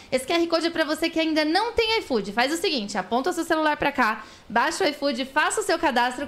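upbeat sponsor pitch to the viewers; ad read. Acted. Host pitching iFood promo to viewers, upbeat ad read. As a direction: sell it like a live ad.

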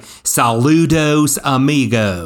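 exaggerated American-accented Spanish, drawn out; slow, heavily anglicized Saludos amigos; gringo accent. Shown as a demonstration. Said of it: "my best gringo accent".

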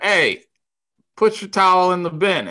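high, loud scolding order; calling out. Retold or imitated. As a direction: call across the clubhouse to tell him off.

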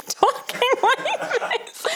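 put-on high, slow Southern accent through laughter. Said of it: "talked in a Southern accent".

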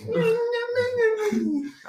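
squealing laugh burst; shrill little cackle; high-pitched laugh. Real, not acted.

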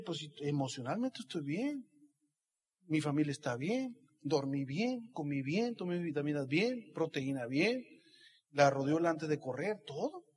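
quiet inner checklist. Retold or imitated. Inner checklist quoted to himself.

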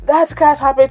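squeaky high mock no, then settling; mock inner voice of doubt. To listeners, mocking the voice of doubt in a high squeak.

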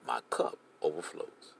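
hushed whisper, slow and low; preacher whispering the psalm verse; whispered scripture. Acted. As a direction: breathe My cup overflows like a secret. Quoting psalm, drops voice.

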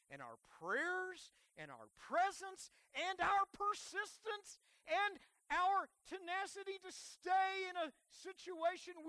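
high, emphatic pulpit build; rousing preaching. Real, not acted. Preacher urges the church to stay for God's glory, rousing preaching. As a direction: lift the voice and press through the list.

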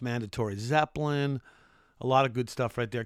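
ordinary talk

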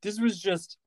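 ordinary delivery

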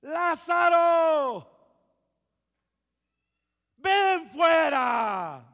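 slow booming shout, very loud and high; loud cry. Shown as a demonstration. Preacher shouting Jesus's command to Lázaro. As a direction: call Lázaro out of the tomb at full voice. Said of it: "clamó a gran voz".

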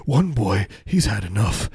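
ordinary talk, nothing marked

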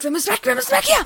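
frightened high yell; panicked shout. Pretend. Sees bloody woman, panics. (panisch schreiend)

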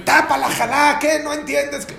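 high, loud mock outburst; hammed-up impatient shout over the table; angry scolding. Husband snapping at his family over the challah, angry scolding.

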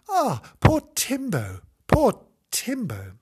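high, cooing lament; mock pity. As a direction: pour exaggerated sympathy on the poor thing. Reader pities lonely toy dog.

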